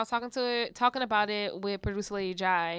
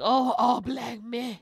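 mocking drawl; 3 semitones higher, 5 dB louder, much slower than usual. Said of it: "That don't mean I'm like".

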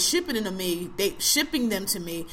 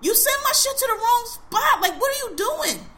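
much higher, exasperated scolding; demanding to know, higher and exasperated; indignant yell; 13 semitones higher than usual. Retold or imitated. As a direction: chew him out over the wrong spot. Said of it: "I'm like".

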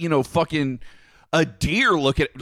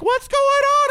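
high, far-off worried mother calling; mom's alarm; 4 dB louder, slower than usual. Retold or imitated. Mother hearing her child scream in the house, calling. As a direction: call out like a startled mom. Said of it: "Mama's like".

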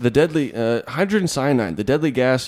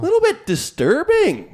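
much higher, louder dramatic repeat; mock dramatic; 4 dB louder than usual. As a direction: squeal it in mock alarm.

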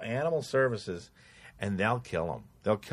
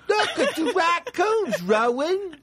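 high, slow hammed-up dad voice; mock doting dad; slower than usual. Imitating dad showing his child the raccoons, mock doting. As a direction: coo to a child in a put-on high voice.

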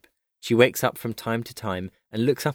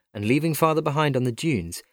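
ordinary delivery, nothing marked

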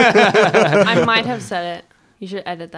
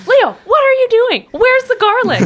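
exasperated call-out; 12 semitones higher, 4 dB louder than usual. Retold or imitated. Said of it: "I'd be like".

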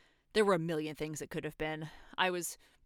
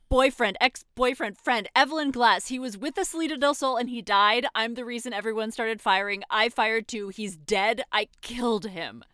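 raised, high and loud, close to shouting; anguished outburst; 4 semitones higher, 7 dB louder than usual. Acted. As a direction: blurt the confession in distress. Keeps pouring out the confession.

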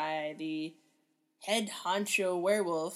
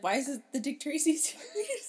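laughing; 7 semitones higher than usual. Retold or imitated. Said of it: "everyone's like".

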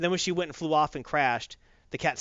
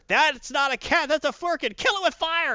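Rocket Raccoon alarmed; 10 semitones higher, 5 dB louder than usual. Retold or imitated. Rocket warns Carol about the Flerken, high and frantic. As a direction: warn her as if the cat will explode. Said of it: "Rocket Raccoon tells her".

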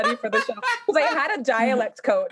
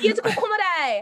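It's Komodai, slow and high, Australian; Australian accent; 11 semitones higher, slower than usual. Pretend. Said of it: "your Australian accent".

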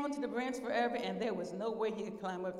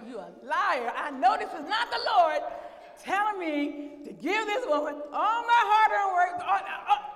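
mock outrage, high and very loud; indignant rebuke; 9 semitones higher, 9 dB louder than usual. Retells rebuking the devil over money.

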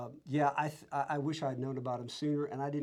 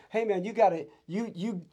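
higher, louder urgent plea; retold preachy warning, pitched up; preaching; 5 semitones higher, 5 dB louder than usual. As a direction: earnestly plead with a stranger. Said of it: "You were preaching".

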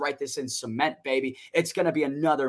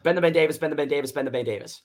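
tongue twister said fast; faster than usual. Shown as a demonstration. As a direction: rattle off "Ben the Ben Davis" three times quickly. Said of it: "three times as fast as you can".

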